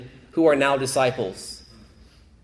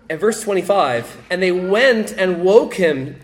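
rising urgency; 7 semitones higher, 7 dB louder than usual. Acted. Preacher building to the disciples' cry.

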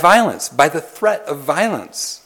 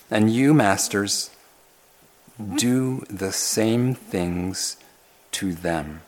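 scripture reading; 5 semitones lower, much slower than usual. Acted. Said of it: "Verse nine".

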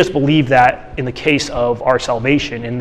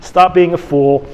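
much higher, louder no-nonsense command; blunt scolding; 5 semitones higher, 4 dB louder than usual. Retold or imitated. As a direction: bark him back to work. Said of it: "Katie's more just like".

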